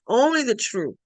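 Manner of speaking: ordinary talk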